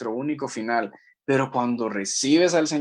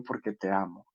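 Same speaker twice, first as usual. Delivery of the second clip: soft loving reply; 5 semitones lower, 8 dB quieter than usual. Retold or imitated. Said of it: "Y él solo me respondió".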